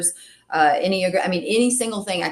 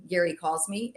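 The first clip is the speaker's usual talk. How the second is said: ordinary delivery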